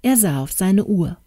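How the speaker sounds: plain reading voice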